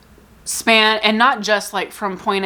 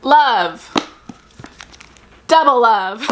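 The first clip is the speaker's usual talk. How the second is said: excited; 3 semitones higher, 4 dB louder, much slower than usual. Real, not acted. Showing tabbed books excitedly.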